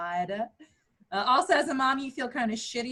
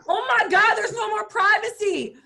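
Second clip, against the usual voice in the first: higher, louder outburst of exasperation; exasperated; 10 semitones higher, 6 dB louder than usual. Real, not acted. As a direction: throw up your hands, oh my God. Exasperated complaint about privacy.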